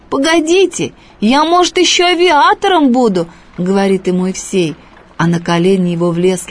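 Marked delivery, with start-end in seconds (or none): 0.1-3.3 put-on boy protesting. A narrator's reading.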